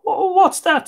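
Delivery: frightened cry. A narrator's reading. Tig hearing the corpse speak, startled high cry. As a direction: jump in fright, voice shooting up. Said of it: "the sweat running from his forehead".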